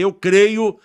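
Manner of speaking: loud, high cry of faith; triumphant shout. Retold or imitated. Son's third proclamation of faith to God, loud shout. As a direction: proclaim it with full force. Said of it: "Falei a terceira vez".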